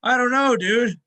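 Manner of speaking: high, loud, rattled reply; mock freaked out. Lifter retelling his shaken reply to manager, mock panic. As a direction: sound shaken up, hammed a bit.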